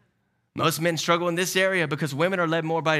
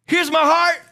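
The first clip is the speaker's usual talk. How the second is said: higher, louder, far proud offering; mock offering; 9 dB louder than usual. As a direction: hand it over grandly. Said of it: "we say".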